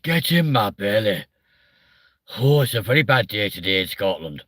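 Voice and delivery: hammed-up, outrageous Scottish accent; bad Scottish accent. Shown as a demonstration. Comic shows how bad his Scottish is, hammed-up Scottish accent. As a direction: put on a poor Scottish burr. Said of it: "my Scottish one just sounds outrageous".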